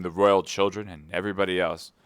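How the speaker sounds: ordinary delivery, nothing marked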